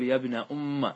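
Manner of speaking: doubled m in أم followed by "a"; fatha on the mim. Shown as a demonstration. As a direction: end أم on an open "a": "yabna umma". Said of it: "بفتح الميم".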